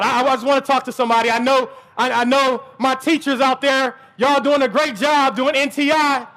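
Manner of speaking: usual speaking voice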